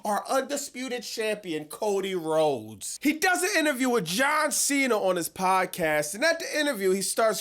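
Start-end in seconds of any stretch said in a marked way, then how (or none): none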